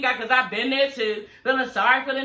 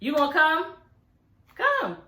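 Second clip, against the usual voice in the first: rising to a much higher call; coaxing the dog; 5 semitones higher than usual. Real, not acted. Owner asking her reluctant dog to come, high coaxing call. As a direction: coax him with a high pet voice.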